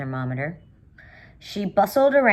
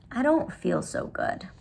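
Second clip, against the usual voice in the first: put-on slow, croaky sick girl; 6 semitones higher, 4 dB quieter than usual. A narrator's reading. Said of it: "in a low, croaky sort of voice".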